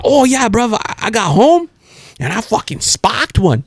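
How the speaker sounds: high Australian impression; mock Australian accent, drawn out at the end. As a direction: imitate an Australian buddy bragging. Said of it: "he's Australian me".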